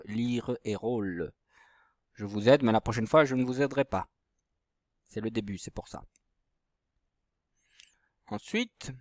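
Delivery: ordinary delivery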